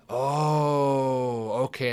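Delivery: long drawn-out "oh" of understanding; dawning realization. Retold or imitated. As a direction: let it click slowly. Acting out his moment of understanding.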